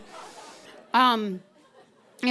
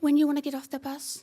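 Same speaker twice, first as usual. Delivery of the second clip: higher, quick mocking question; taunting repeat; 4 semitones higher, faster than usual. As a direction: taunt her with her own question. Driver taunts her up close.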